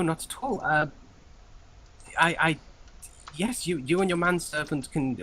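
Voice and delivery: high, eager-to-please flustered clerk; grovelling clerk. Acted. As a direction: fawn over the lady, pitch rising. Clerk grovels to noble lady.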